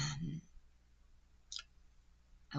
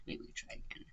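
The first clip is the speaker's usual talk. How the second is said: a soft unvoiced repeat, half to himself; whispered aside; 17 dB quieter than usual. Real, not acted. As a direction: whisper it under your breath to yourself.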